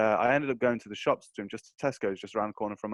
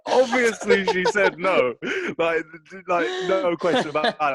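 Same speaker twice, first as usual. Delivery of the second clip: laughing; 8 semitones higher, 7 dB louder, slower than usual. Real, not acted. Laughing while telling.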